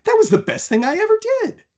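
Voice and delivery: very high, fast, loud and giddy; a little beast bursting with glee; fast high-pitched excited creature voice; thrilled squeal. Acted. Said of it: "shook with excitement".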